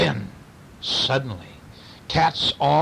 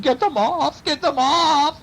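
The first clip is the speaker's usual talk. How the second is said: high, wailing plea; pleading; 12 semitones higher, slower than usual. A narrator's reading. Burglar covered in cats, high desperate pleading. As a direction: beg in acted desperation. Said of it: "he pleaded".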